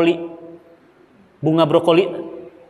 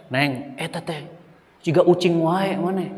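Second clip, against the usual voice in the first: village elder scolding; 2 semitones lower, 5 dB quieter than usual. Acted. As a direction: tease her like an old villager. Villager scolds nursing mother. (teguran orang kampung, lebih pelan)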